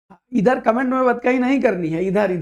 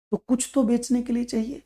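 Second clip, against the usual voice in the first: ordinary talk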